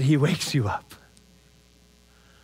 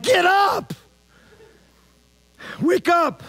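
mock yell; 10 semitones higher, slower than usual. A disciple hollers at sleeping Jesus, a mock yell. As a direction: yell at him to get up, half-joking. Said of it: "which one is just kinda like".

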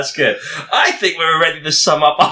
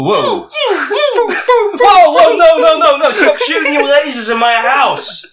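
mock alarm; 10 semitones higher, 5 dB louder, slower than usual. Friend "blasting lasers" in his house.

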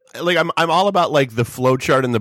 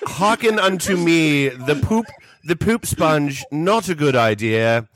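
high, slow olde English herald; hammy stage actor; 4 semitones higher than usual. Acted. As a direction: proclaim it like a town crier with a wink. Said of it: "scientifically oriented guy going to the town council".

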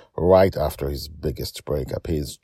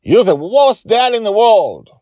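much higher, louder furious cry; angry shout; 11 dB louder than usual. Acted. Joy angrily shouts at her dad. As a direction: hurl it at him. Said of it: "shouts at him angrily".

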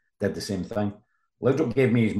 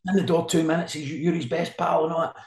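much higher furious roar of the name; roaring boss; 9 semitones higher, 3 dB louder than usual. Retold or imitated. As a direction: roar the name in the reporter's face. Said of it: "he's roaring at me".

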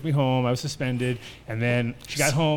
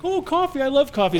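high, chirpy mock grandmother; 13 semitones higher, 6 dB louder than usual. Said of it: "she's like".

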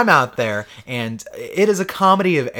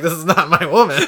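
laughing protest; 4 dB louder than usual. Retold or imitated. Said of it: "And I was like".

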